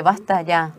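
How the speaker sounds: stern and serious. Retold or imitated. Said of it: "me puse seria".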